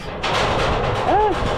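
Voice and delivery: sharp frightened cry; sudden high yelp of fear; scared yelp. Real, not acted. Scared of heights, cries out.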